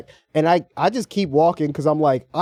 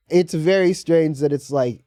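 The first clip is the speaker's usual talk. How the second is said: ordinary delivery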